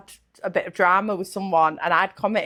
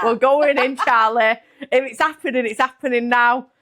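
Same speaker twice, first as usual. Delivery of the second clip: raised, louder mock battle cry; retold self-psyching; 5 semitones higher, 5 dB louder than usual. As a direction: hype yourself up as if charging in. Retold pep talk to herself.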